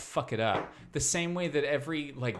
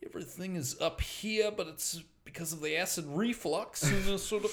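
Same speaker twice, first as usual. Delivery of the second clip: put-on higher, softer old Aussie accent, strained; older Australian, indigestion; 4 semitones higher, 5 dB quieter than usual. Shown as a demonstration. Comedian playing older Perth Australian with reflux, strained Aussie accent. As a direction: talk through heartburn in an old Australian accent. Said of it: "indigestion of the older Australian".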